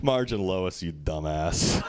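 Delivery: low mocking correction. Real, not acted. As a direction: scoff at the mistake.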